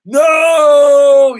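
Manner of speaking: high, loud, long-held NO; drawn-out shouted no. Acted. Reading chat votes on a game review aloud, drawn-out shout. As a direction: holler it out in mock protest.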